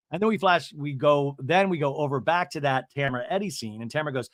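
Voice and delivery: ordinary delivery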